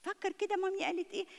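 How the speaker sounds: put-on mother coaxing her child to repeat back; prompting child. Shown as a demonstration. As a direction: gently nudge the kid to recall. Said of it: "قول له".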